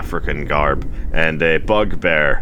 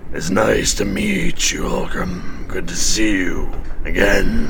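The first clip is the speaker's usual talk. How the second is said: bugbear Gary; 2 semitones higher than usual. Acted. Bugbear Gary greets Ogum, mock-friendly voice. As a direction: greet an old rival with false warmth.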